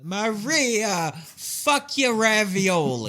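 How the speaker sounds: hammed-up Jersey yell. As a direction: bellow it like a cartoon Jersey guy. Mock Jersey Italian yelling.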